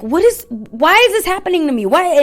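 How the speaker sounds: high, loud mock despair; re-enacted wail of "why me"; acted anguished plea. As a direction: plead upward as if questioning God. Acts out her plea to God.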